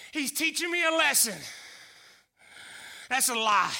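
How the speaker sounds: ordinary delivery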